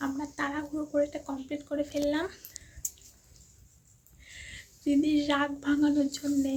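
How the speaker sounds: hushed voice. Real, not acted. Secret birthday prep, hushed so sister can't hear. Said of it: "কথা বলতে পারছি না, দিদি শুনতে পাবে".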